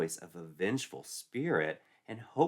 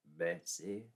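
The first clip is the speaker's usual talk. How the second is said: lower, softer, hesitant man's voice; concerned teacher; 4 semitones lower, 5 dB quieter than usual. Acted. Mister Powell finding Betsy crying, soft and hesitant. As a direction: ask gently, a hint of a worried teacher.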